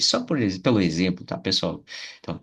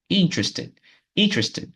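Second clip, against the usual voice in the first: "interested" with high IN, ending in falling "-ed"; first-syllable stress; 8 semitones higher than usual. Shown as a demonstration. Teacher showing the different ending, "interested" stressed on IN. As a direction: start "interested" high, drop to the changed ending. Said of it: "no final que muda".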